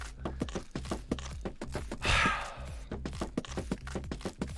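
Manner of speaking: long, weary unvoiced exhale; drawn-out sigh of football frustration; long stressed sigh. Real, not acted. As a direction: let all the air out slowly, fed up. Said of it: "I'm stressed".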